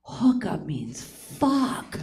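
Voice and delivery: soft, slow whispered reveal; hushed whisper. Retold or imitated. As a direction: lean in and breathe it out slowly. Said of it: "She's like".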